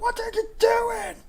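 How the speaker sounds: high, slow mock-alarmed cry; alarmed Duncan. Duncan seeing his recruit brawl, high slow mock-alarmed cry. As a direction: drag it out in exaggerated alarm. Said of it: "Duncan's there like".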